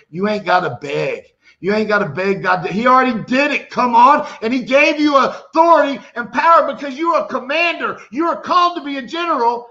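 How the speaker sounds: loud, rising preacher's call; preaching at full force. Real, not acted. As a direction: drive it home like a commander rallying troops. Preacher rises to a shout.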